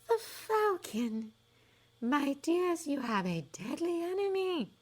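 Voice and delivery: high, slow, dreamy prophetess; a high airy fortune-teller sizing up a doubter; misty seer voice. A narrator's reading. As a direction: float it like a mystic seer. Trelawney reads the teacup.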